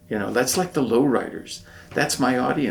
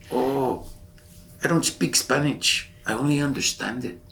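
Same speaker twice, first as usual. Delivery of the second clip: put-on higher-pitched Chicano accent; Louis; 4 semitones higher than usual. Shipmate admitting he speaks no Spanish, Chicano accent. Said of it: "You have this accent as well".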